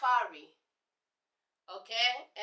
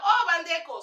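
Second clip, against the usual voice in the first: excited greeting; 5 semitones higher, 7 dB louder than usual. Retold or imitated. Greeting fellow Congolese warmly.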